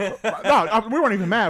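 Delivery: laughing quote. Retold or imitated. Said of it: "We're like".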